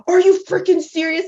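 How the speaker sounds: loud, high exasperated snap; exasperated outburst. Retold or imitated. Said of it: "And then it's like".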